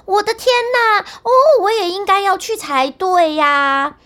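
高声哭喊着; 带着哭腔大声嚷嚷. A narrator's reading. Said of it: "笨蛋汉斯叫道". (带哭腔的高声叫喊)

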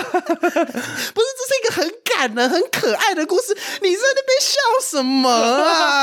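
high-pitched, slow, giggly complaint; squeaky laughing mock outrage; laughing protest. Real, not acted. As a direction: protest through uncontrollable laughter. Protesting while cracking up.

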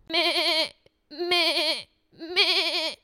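bleating meh like a goat; a bouncy goat's bleat. Acted. Storyteller imitating the goat with bleats.

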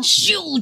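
a sharp, high whooshing "咻"; whoosh sound. Retold or imitated. Describing memories rushing back, a high whoosh. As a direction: fling out a quick rushing noise like memories flooding in.

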